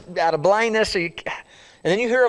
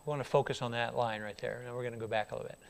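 ordinary delivery

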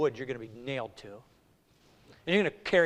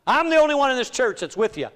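high, loud, over-eager pledge; acted loud boast to the Lord; Peter boasting; 8 semitones higher, 7 dB louder than usual. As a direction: proclaim loyalty with bold self-assurance. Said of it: "We're like Peter".

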